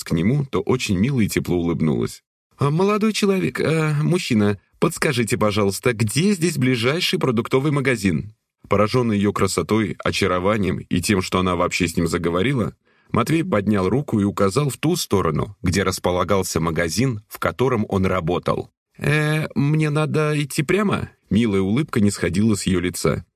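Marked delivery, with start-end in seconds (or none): 2.6-8.3 lightly voiced sweet girl; sweet young woman. A narrator's reading. 19.0-21.1 lightly voiced sweet girl; sweet young woman. A narrator's reading. Woman asks directions.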